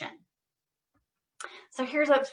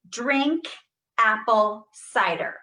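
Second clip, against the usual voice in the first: loud, every word pushed out; loud and proud; 3 dB louder, slower than usual. Shown as a demonstration. Therapist modelling a loud drill phrase, loud and proud. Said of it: "Say it loud and proud".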